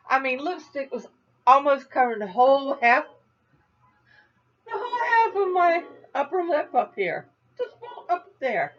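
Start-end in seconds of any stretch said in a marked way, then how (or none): 1.5-8.7 laughing. Real, not acted. Laughing at her own mistake.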